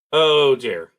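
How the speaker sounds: ordinary talk, nothing marked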